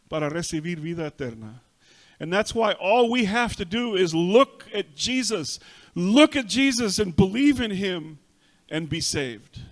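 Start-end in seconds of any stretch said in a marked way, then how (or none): 2.2-9.6 louder, higher urgent appeal; forceful preaching. Acted. Preacher urges the congregation to look at Jesus, forcefully. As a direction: press the call on the listeners. Altar call at full force.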